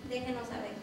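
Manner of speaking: normal talking voice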